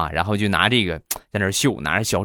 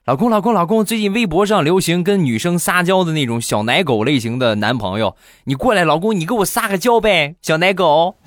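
higher cutesy pleading; coaxing wife; 7 semitones higher than usual. Acted. Wife begs husband to act cute, higher coaxing voice. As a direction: wheedle him into acting sweet.

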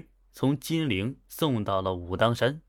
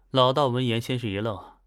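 ordinary delivery

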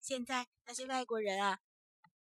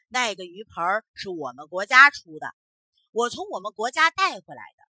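much louder, brash boasting; the foreigner loudly lies to the magistrate, brash; loud false claim; 14 dB louder than usual. Acted. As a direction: shout the lie with full confidence.

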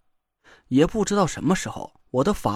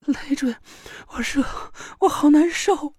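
high weepy pained voice; tearful girl; 10 semitones higher than usual. Acted. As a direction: whimper it, close to tears. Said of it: "田慧文哭了起来".

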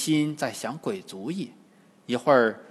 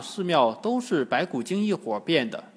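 ordinary delivery

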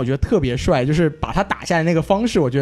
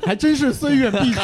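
laughing; 8 semitones higher than usual. Real, not acted. Laughing echo of slogan.

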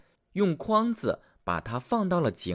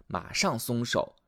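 ordinary delivery, nothing marked

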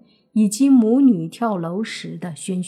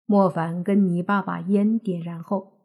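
usual speaking voice

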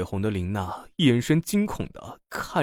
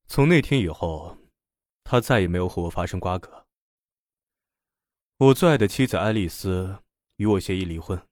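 low, melancholy confession; a touch of sorrow, pitched down; wistful; 4 semitones lower than usual. A narrator's reading. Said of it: "眼神凄迷地说道".

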